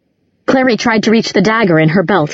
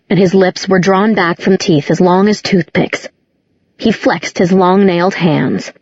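ordinary delivery

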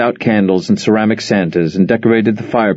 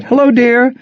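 much higher, bright surprised mother; delighted phone greeting; 14 semitones higher than usual. Acted. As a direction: greet your daughter warmly on the phone.

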